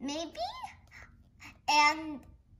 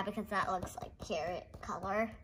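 whisper; 5 semitones lower, 8 dB quieter, faster than usual. Real, not acted. Aside while reading book.

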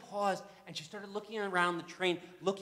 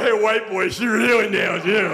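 teasing passenger; 4 semitones higher, 14 dB louder than usual. Retold or imitated.

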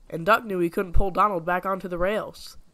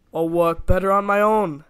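louder, haughty sniff of a line; 7 dB louder than usual. A narrator's reading. Douglas sniffing proudly about working alone. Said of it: "sniffed Douglas".

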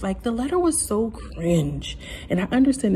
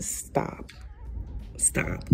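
breathy whispered plea to stop; hushed exasperated stop; 12 dB quieter than usual. Real, not acted. Pleading with Iggy to stop.

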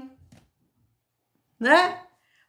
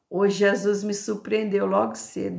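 ordinary talk

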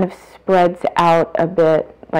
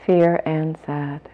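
slow, even labeling with long pauses; note each feeling slowly and evenly, letting silence follow; meditative noting; 5 dB quieter, slower than usual. Real, not acted. Labels feelings aloud during guided meditation.

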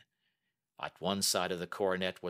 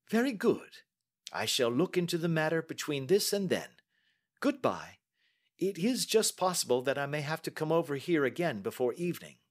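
lightly voiced calm detective, higher; a brisk, confident Holmes; the detective testing the banker, lightly voiced; Holmes, cool and measured; 7 semitones higher than usual. A narrator's reading. Holmes voiced while reading.

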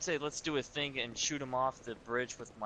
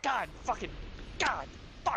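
frustrated curse; 8 semitones higher than usual. Real, not acted. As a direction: swear in frustration. Frustrated outburst.

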